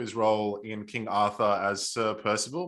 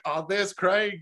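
excited; 8 semitones higher, slower than usual. Retold or imitated. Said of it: "we're like".

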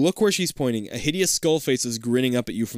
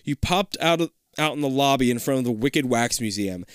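plain reading voice

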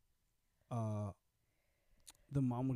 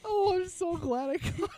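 high, loud, slow words through laughter; shrieking laughter; 9 dB louder than usual. Real, not acted. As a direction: squeal it while cracking up. Laughing at friend's bug panic.

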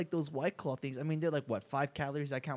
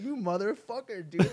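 put-on lightly raised, playful kid insult; mock cursing kid; 8 semitones higher than usual. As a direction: toss the curse up high, half joking. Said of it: "how you talk to your dad".

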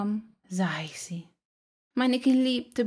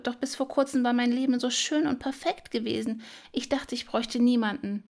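pleading prayer in a raised pitch; earnest prayer; 4 semitones higher than usual. A narrator's reading. Trapped spider praying to Allah in her sadness, earnest pleading. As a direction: implore from the depths of despair, voice lifted. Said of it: "fing an zu beten".

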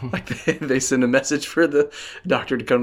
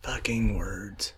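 whispered aside; 3 semitones lower, 9 dB quieter, much slower than usual. Real, not acted. Mutters aside.

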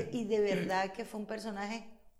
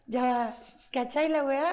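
a bright, louder Chilean slang imitation, said half laughing; mock Chilean; 5 semitones higher, 6 dB louder than usual. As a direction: throw it out like a Santiago local. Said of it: "imita el acento chileno". (acento chileno de imitación, con risa)

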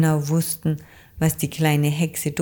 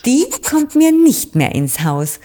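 hoch, laut und entschieden; bestimmt; 9 semitones higher, 6 dB louder than usual. A narrator's reading. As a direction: sag es streng als Amselfrau. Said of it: "sagte die Amselfrau Arete bestimmt". (Amselfrau Arete lehnt bestimmt und laut ab)

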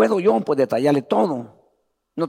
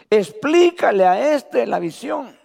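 slow, raised reading of scripture; lectura bíblica; 6 semitones higher, slower than usual. Acted. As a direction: proclaim the verses to the church. (lectura solemne de la Escritura en voz alzada)

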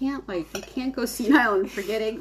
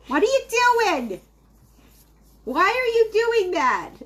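put-on high, slow nosy old neighbor calling out; nosy old lady; 9 semitones higher, 4 dB louder than usual. Old lady next door yelling at the neighbors' cornhole game. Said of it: "I'm gonna be the weird little old lady next door".

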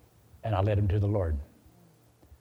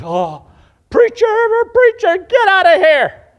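very high, loud shriek, slowing on the last words; frantic scream; 14 dB louder, slower than usual. Retold or imitated. Gunman's wife warning the preacher away, screamed in alarm. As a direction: scream a desperate warning, in imitation.

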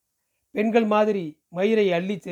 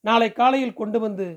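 plain reading voice

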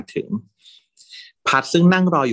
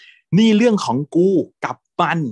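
slow, higher, louder bark; furious shout; 8 semitones higher, 6 dB louder, slower than usual. Retold or imitated. Drunk boyfriend shouting at a rival, slow and loud. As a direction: bellow it, word by word. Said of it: "ตวาดเสียงดังมาก".

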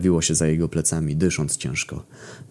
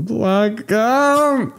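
much higher, louder wailing Błagam, falling; wailing plea; 4 dB louder than usual. A narrator's reading. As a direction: break into a desperate wail on błagam. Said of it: "zaczął jęczeć panicznie".

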